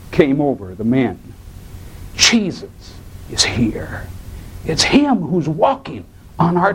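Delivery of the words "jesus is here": slow hushed whisper. Acted. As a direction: breathe it out in awe. Hushed reveal of Jesus' presence.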